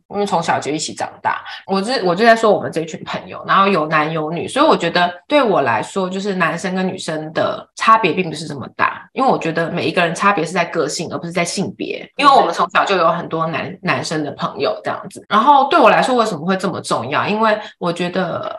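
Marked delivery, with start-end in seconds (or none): none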